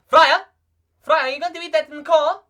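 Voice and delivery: loud, high South African accent. Shown as a demonstration. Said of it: "more of a South African kind of deal".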